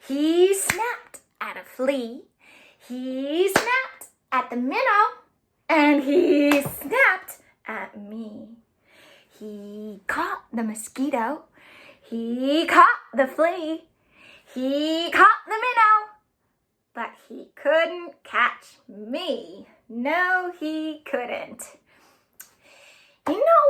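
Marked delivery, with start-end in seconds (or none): 0.0-8.6 lively rhythmic read-aloud; bouncy storytime rhythm; reading to children. A narrator's reading. 9.4-21.8 lively rhythmic read-aloud; bouncy storytime rhythm; reading to children. A narrator's reading.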